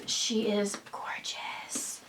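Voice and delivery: soft awed whisper. Real, not acted. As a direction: whisper it in awe. Admiring the bag.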